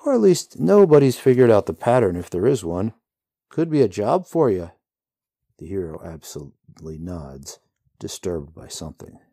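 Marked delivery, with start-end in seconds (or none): 0.1-4.7 higher, louder, offhand rider voice; breezy rider voice. A narrator's reading. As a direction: shrug off horror lightly. Rider's voice in story.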